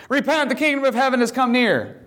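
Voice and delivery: high-pitched yell without extra volume; preacher's cry, lightly acted; mild mock yell. John the Baptist preaching repentance, mock yelling at a high pitch. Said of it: "comes out yelling that".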